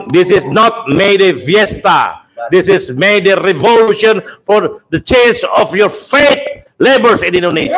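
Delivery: slow, high rally proclamation; fiery revolutionary speech. Real, not acted. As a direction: drag out each word to the crowd. Rally speech repeated, slower.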